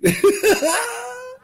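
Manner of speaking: high, loud burst of laughter; a loud, high cackle of amusement; big laugh. Real, not acted. Joking, then bursts out laughing.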